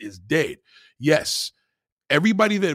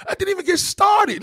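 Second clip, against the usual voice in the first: exasperated shout; 13 semitones higher than usual. Real, not acted. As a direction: yell it in frustration. Shouting at a guest.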